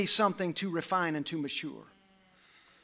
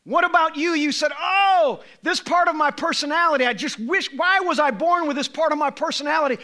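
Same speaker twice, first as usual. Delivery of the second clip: high, louder pleading preaching; fervent preaching; 8 semitones higher, 6 dB louder than usual. Acted. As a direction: call out the listener's doubts. Preaching to the listener.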